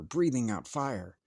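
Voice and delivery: ordinary delivery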